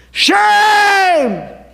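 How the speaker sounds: high, loud bellow of shame. Retold or imitated. Pastor bellowing shame at lawmakers, high and loud. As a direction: bellow it across the chamber. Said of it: "I bellowed out".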